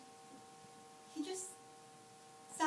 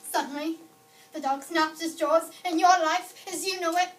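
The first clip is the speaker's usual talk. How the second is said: loud, higher-pitched burst of shock; sudden alarm; 4 semitones higher, 13 dB louder than usual. Acted. Telling of the dog suddenly snapping, loud and alarmed. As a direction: hit it hard, suddenly much louder.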